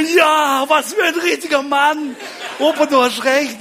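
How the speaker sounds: high, loud, hammed-up elation; mock jubilant cheer. Boy cheers grandpa for freeing him from chores, mock jubilant. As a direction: draw it out in exaggerated admiration.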